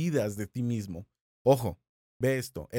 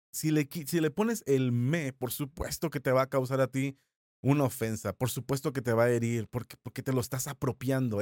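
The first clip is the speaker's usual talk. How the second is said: ordinary talk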